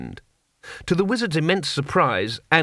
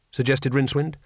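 usual speaking voice

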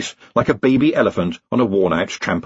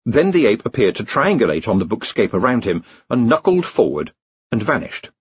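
ordinary delivery, nothing marked

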